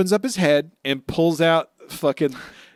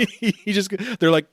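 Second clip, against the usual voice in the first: ordinary talk, nothing marked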